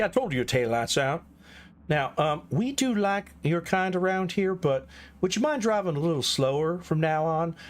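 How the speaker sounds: hammed-up Southern police officer; camp Southern. Shown as a demonstration. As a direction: play a courteous Southern cop making a traffic stop. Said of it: "I was going Camp Southern".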